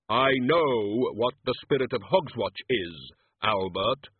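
slow, hollow, grave; Death's voice. Acted. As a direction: speak as Death, slowly.

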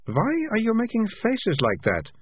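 put-on high girl's voice, puzzled and sharp; girl questioning the cat's odd faces; Malicia's voice. A narrator's reading. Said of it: "said Malicia".

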